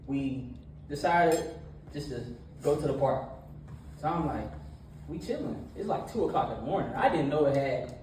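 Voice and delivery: energetic church talk; preaching. Acted. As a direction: preach it to the young crowd with energy. Youth sermon with retold story.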